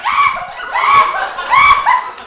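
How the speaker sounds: very high, loud mock scream; hammed-up terrified scream. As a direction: shriek in freefall. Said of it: "I'm screaming".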